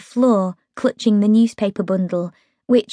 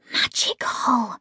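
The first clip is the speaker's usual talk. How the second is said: soft, slow, awed breath; 2 semitones higher, 7 dB quieter, slower than usual. A narrator's reading. Megan dazzled by the magic advert, breathed softly. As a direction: breathe the word in wonder. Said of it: "Megan breathed".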